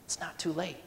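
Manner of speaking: reassuring promise. Retold or imitated. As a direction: tell them kindly it is not too late. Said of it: "he's saying".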